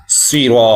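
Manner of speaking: سیرا with thin s; wrong reading of صراط; wrong pronunciation. Shown as a demonstration. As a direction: show the mistaken thin سیرا. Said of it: "سیرا ایسے نہیں پڑھنا".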